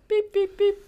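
high, loud mock beeping; phone dial beeps. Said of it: "I'm calling triple zero".

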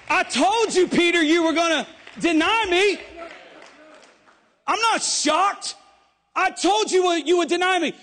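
shouted, high Jesus voice; Jesus rebuking Peter. Acted. Jesus telling Peter he foresaw the denial, shouting.

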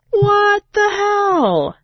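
very high, slow, stretched-out cry of disbelief; stunned disbelief. Real, not acted. Reacting to the kid hearing the noise, drawn-out shocked cry. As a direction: wail it out, totally floored.

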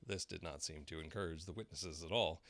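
usual speaking voice

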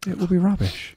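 gloomy. Acted. Gloomy repeat of own line.

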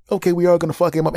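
very high, fast, loud phone voice; Texas friend. Retold or imitated. Friend calling relatives, high fast excited voice.

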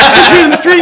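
high, loud chirp; high chirpy quote. Retold or imitated. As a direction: chirp it like a proud kid. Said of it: "he goes, like".

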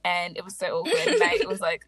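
usual speaking voice

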